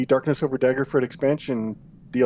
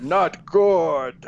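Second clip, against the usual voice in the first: put-on ogre grunting out a verdict; hammed-up ogre voice; ogre impression; 3 semitones higher than usual. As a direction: give the critique as a comic ogre. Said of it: "That's your ogre impression".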